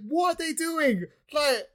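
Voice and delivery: high, disbelieving exclamation; exasperated outburst. Real, not acted. Friend ranting about a manga ending, high exasperated disbelief. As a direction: voice jumps up in outrage at the plot.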